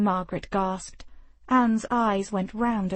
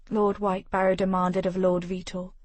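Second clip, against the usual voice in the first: ordinary delivery, nothing marked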